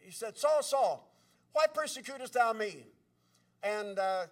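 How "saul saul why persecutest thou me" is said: raised, solemn heavenly call. Retold or imitated. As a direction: call out as the Lord confronting Saul. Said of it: "he was hearing a voice from heaven".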